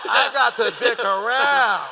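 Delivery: loud, high and slow, laughing through it; laughing shout. Retold or imitated. Mocking his boss's line to the interviewer with a loud high laugh. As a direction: crack yourself up yelling it. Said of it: "I'm like".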